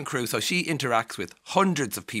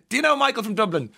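high, loud, quick imitated enquiry; hammed-up stranger asking after acquaintances; mock eager question; 13 semitones higher, 7 dB louder, faster than usual. Stranger abroad quizzing an Irish traveller, mock eagerness. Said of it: "the first question is".

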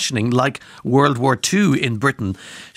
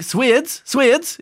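Swedes in a high, lilting Cork accent; put-on Cork accent on Swedes, pitched right up; 13 semitones higher, 5 dB louder than usual. Shown as a demonstration. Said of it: "in Cork accent, phonetically pronounced".

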